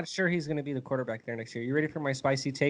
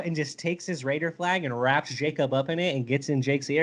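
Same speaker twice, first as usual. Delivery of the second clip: ordinary talk, nothing marked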